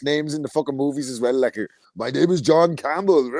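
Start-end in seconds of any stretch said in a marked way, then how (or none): 1.9-3.3 slow, lightly voiced Austrian accent of Arnie. Mocking Arnie's movie names.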